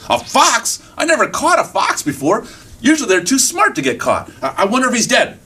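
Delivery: high, wondering hunter voice; surprised hunter. Acted. Hunter finds a fox in trap, amazed. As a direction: puzzle it out in a high voice. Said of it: "Hunter looks over".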